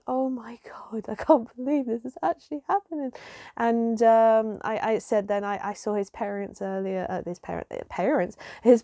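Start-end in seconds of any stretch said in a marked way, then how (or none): 0.0-3.1 high, breath-filled gasp of disbelief; overwhelmed, airy and raised; disbelieving inner voice. Retold or imitated. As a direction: retell a stunned thought, half whispered. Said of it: "And I'm just thinking".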